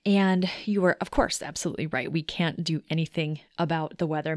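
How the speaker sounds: ordinary delivery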